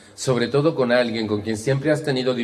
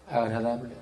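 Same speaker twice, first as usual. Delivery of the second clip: agradable dropped very soft; hushed demonstration of agradable; changed tone; 8 dB quieter than usual. Shown as a demonstration. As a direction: say it softly, almost under the breath. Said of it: "¿Cambia?".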